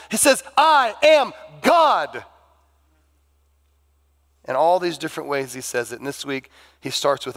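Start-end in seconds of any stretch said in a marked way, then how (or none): 0.6-2.2 I am God slow, high and loud, every word punched; emphatic proclamation. Acted. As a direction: hammer each word home. Climax of sermon contrast.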